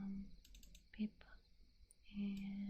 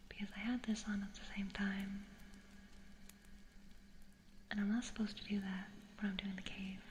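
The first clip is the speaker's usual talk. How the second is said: whisper; 11 dB louder than usual. Real, not acted. Whispering to stream viewers, puzzled whisper. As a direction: breathe the words, no voice.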